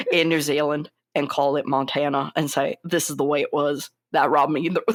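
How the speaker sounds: low-pitched cowboy impression; Sam Elliott. Co-host mimicking the actor's rant about the director, deep cowboy impression. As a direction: drop into a deep, folksy Western old-timer voice. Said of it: "let me do my Sam Elliott impression".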